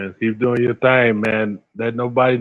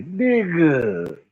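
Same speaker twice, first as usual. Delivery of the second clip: put-on West Coast accent; 5 semitones higher, 3 dB quieter than usual. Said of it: "That's a West Coast boy there".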